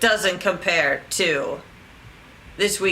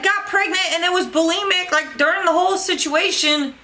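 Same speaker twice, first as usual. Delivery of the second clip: ordinary talk, nothing marked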